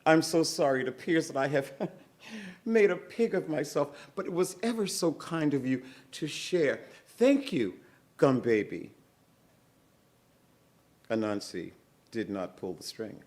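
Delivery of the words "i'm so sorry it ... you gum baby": high, sugary coaxing voice; butter up the gum baby for yams; sweet-talking Moesha. Acted. Moesha coaxing the gum baby, sweetly. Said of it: "she stood and she said".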